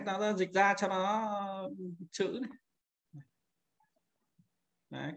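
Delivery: usual speaking voice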